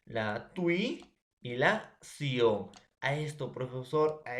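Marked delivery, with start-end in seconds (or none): 0.0-2.7 "in", "tui", "sion" said slowly with gaps between; teacher dividing intuición slowly; syllables split apart. Shown as a demonstration. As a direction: break the word into slow separate syllables. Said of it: "las sílabas va a ser".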